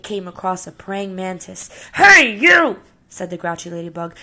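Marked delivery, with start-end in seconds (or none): 1.9-2.8 high, loud shout at a stranger; bully's call. Acted. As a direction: pick a fight from across the room. Grouchy ladybug picks fight.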